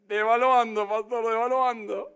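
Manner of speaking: grito desde el público, más fuerte; respuesta gritada. Real, not acted. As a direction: responde a viva voz al pastor. Congregante responde en voz alta. (grito del público al pastor)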